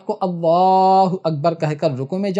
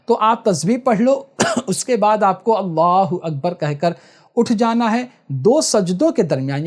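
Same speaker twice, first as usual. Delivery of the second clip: normal talking voice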